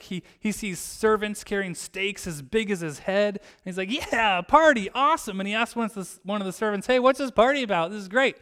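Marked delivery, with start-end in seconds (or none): 3.9-5.4 high, loud party cheer; acted excitement. Son spotting his dad's party, acted excitement. 6.9-8.4 high, loud, quick eager question; acted eager excitement. Older son asking a servant about the party, acted excitement.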